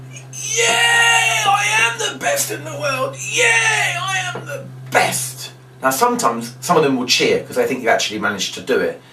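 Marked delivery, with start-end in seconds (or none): 0.1-5.5 very high, hammed-up squeals of glee; squeaky mock bragging; gloating victory cry. Shown as a demonstration. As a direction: boast like the world's best magician. Fake magician gloating at kids.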